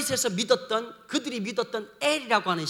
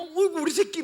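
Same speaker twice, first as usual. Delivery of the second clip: higher, fond cooing; doting mother; 6 semitones higher than usual. Retold or imitated. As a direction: coo over your own kid.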